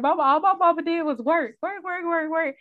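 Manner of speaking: put-on high loud childish complaint; imitating her daughter. Daughter complains mama only works, high childish whine. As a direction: whine it like a little kid. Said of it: "she always like".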